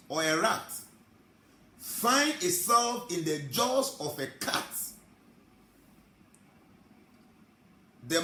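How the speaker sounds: slow, loud, high preacher build-up; preaching. Acted. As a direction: drive the parable home from the pulpit. Preacher builds the cat parable.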